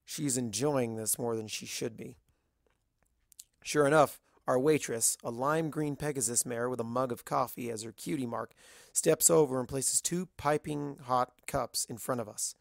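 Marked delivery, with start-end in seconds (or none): none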